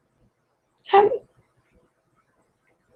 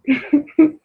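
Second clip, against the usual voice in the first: a short laugh with a different colour; amused laugh after the chant; breathy little laugh; 5 semitones higher than usual. Real, not acted. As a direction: chuckle it off.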